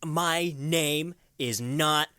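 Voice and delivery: mock outraged writer yelling at his housekeeper; pissed-off outburst. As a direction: bark out each offended question. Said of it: "he's so pissed".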